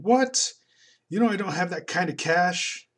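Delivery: high, loud shocked yell; comic scream. Acted. Comic guy screaming that he has no cash. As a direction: yell it back in outrage. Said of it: "And this guy is screaming".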